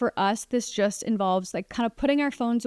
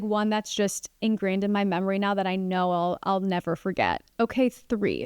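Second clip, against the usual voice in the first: usual speaking voice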